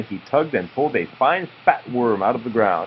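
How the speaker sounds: usual speaking voice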